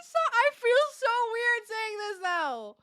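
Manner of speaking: high, slow, laugh-cracked whine; giggly embarrassed complaint. Real, not acted. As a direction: squeak it out, half crying with laughter.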